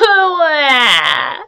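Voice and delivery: a loud, high mock wah; wailing. Little Beaver wails out loud by the pond, loud mock wah. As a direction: howl it like a bawling baby. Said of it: "said Little Beaver".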